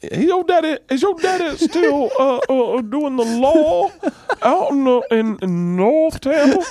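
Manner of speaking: high, slow, halting Carolina drawl; Southern buddy's dad. Acted. Buddy's dad asks about the father's law work, slow high Southern drawl. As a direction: stumble along in a high mock Southern accent. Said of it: "That's the interesting accent".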